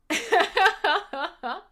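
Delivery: loud high burst of laughter; laughing. Real, not acted. Host cracks up while listing follower purges, loud laughing. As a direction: break into a rising cackle mid-sentence. Host breaks up mid-sentence.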